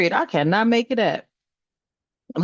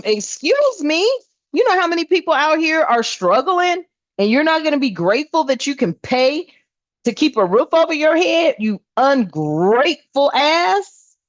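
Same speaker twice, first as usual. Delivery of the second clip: high-pitched disbelieving rant, slowing hard on the last words; outraged high scolding, dragging out "you ungrateful ass"; indignant outburst; 8 semitones higher than usual. Real, not acted. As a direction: scold them as if they can't be serious, rising high.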